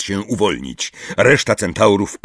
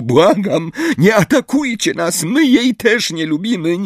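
high, breathless pleading; Hermiona; 13 semitones higher than usual. A narrator's reading. Hermione begs centaurs not to attack, breathless. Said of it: "której wyraźnie brakło tchu".

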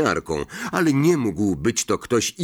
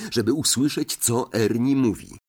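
ordinary delivery, nothing marked

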